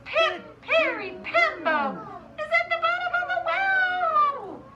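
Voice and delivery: very high, loud shout, drawn out; big hollering storybook climax; full shout. Acted. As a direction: shout each piece of the name slowly for children to join. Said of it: "he shouted".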